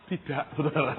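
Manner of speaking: high voice breaking into laughter; laughing admission. Real, not acted. Preacher admitting no miracles to congregation, laughing. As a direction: confess it and burst out laughing. Laughs at his own honest answer.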